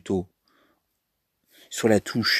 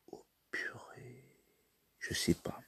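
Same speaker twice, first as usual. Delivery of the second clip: much softer, slow, stuck whisper; stumped whisper; 19 dB quieter than usual. Real, not acted. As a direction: whisper to yourself, you have no idea.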